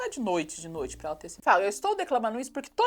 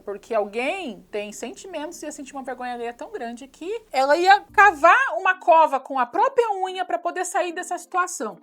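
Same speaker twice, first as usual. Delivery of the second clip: ordinary talk, nothing marked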